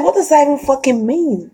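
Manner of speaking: high, rising outraged retort; retold indignation. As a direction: tell him off in disbelief. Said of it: "I said".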